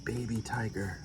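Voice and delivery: hushed whispered aside. Real, not acted. Pointing out a baby tiger to listeners, whispered. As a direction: breathe it as a secret.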